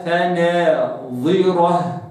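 فناظرة very slow, with a heavy emphatic ẓā; فناظرة, not فناذرة. Shown as a demonstration. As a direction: draw out فناظرة with full emphasis. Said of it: "قلتش فناذرة".

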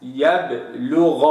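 slow يبلغ with bounced ب; qalqala on baa. Shown as a demonstration. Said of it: "هكذا".

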